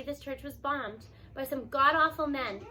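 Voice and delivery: ordinary delivery, nothing marked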